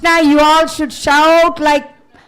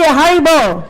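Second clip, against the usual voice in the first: rousing call. Real, not acted. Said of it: "say it loudly".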